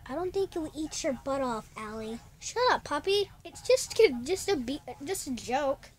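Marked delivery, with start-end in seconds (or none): 0.1-2.2 unvoiced quick whisper; soft teasing whisper. Real, not acted. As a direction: whisper the correction aside. Whispered correction.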